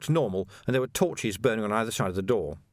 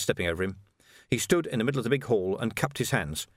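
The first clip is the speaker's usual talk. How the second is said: ordinary delivery, nothing marked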